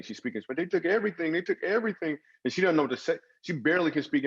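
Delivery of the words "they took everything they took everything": imitating a crying woman. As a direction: voice the neighbour's wife sobbing it out. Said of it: "And she's crying".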